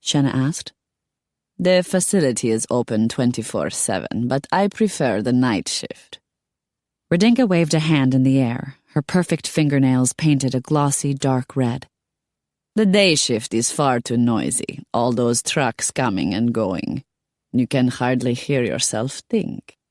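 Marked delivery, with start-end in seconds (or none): 1.6-6.2 put-on foreign-accented woman explaining her hours; slightly lowered accented character voice; Radinka, chatty. 12.7-19.8 put-on accented foreign woman, unhurried; measured grumble in a foreign accent; Radinka, mildly complaining. Voices Radinka in dialogue.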